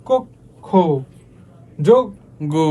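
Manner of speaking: ক, খ, যোগ, গ with ও vowels, "kok... kho, jog... go"; rounded split syllables. Shown as a demonstration. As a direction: break each word in two and draw out final খ and গ. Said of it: "ও এর মতো হয়ে যাবে".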